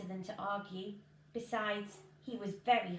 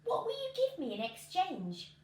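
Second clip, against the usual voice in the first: Jack asking; 8 semitones higher than usual. Acted. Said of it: "so he asked".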